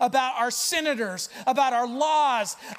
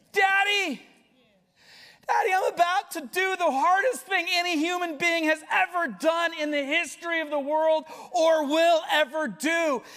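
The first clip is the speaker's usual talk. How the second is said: desperate plea; 6 semitones higher than usual. Acted. Jesus crying to his Father before the cross, desperate plea. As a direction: call out to Daddy in anguish. Voicing Jesus calling Abba.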